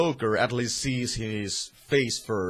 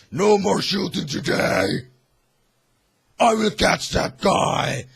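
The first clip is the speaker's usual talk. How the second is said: higher, louder heroic shout; heroic Batman; 10 semitones higher, 8 dB louder than usual. Retold or imitated. Batman calls to the guards, hammed-up heroic shout. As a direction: call it out like a hammed-up superhero. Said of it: "and he's like".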